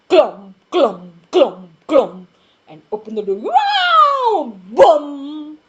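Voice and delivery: stamp and crash; loud stomping sounds. A narrator's reading. Narrator thumping out Mister Noisy's steps and door crash.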